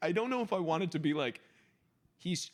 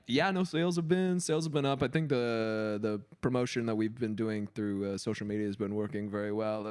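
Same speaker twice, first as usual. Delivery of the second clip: lightly voiced corporate employee; a hint of boardroom update; super corporate; 6 semitones lower than usual. Employee reporting sales in a board meeting, lightly corporate. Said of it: "like, super corporate".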